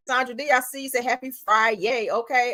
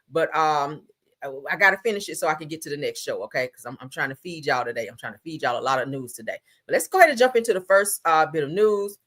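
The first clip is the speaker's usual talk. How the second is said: normal talking voice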